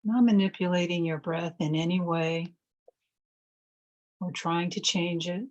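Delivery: calm, measured phrases with long silences between; guided meditation. Real, not acted. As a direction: guide listeners gently into stillness, leaving space to breathe.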